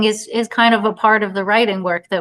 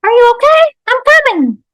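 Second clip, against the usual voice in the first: very high, loud squeaky mimic; Hello the toy; 5 dB louder than usual. Toy mimics the panicked mother, squeaky echo. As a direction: parrot the panic like a talking toy. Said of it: "said Hello".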